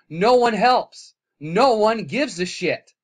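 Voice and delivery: loud, slow, bitter outburst; angry. Real, not acted. Vlogger ranting at viewers, slow angry shout. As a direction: spit it out, slow and furious. Angry rant about nobody helping.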